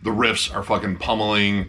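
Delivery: ordinary talk, nothing marked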